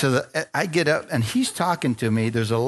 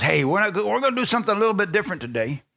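higher, quick imitation of a tent evangelist; evangelist announcing; 7 semitones higher, faster than usual. Evangelist opening the revival service, announcing with energy. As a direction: announce it brightly to the crowd, pitched up.

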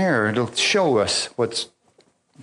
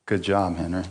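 God's low, soft, wry aside; dry divine reproof; 8 semitones lower, 6 dB quieter than usual. Retold or imitated. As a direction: say it low and soft, as mock praise. God's dry remark, retold.

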